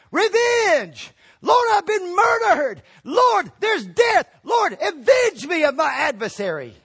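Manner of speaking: high, loud, drawn-out wail; crying for revenge. Acted. Blood of Abel pleading to the Lord, crying for revenge. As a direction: shout a desperate plea to heaven. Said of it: "The blood of Abel was crying".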